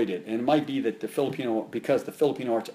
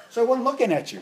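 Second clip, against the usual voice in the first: much higher, a mild take-off of the teacher's voice; imitating Master Fred; 12 semitones higher, 4 dB louder, faster than usual. Instructor talking to students without looking at them, lightly mimicked higher voice. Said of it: "had the habit of doing, talking like this".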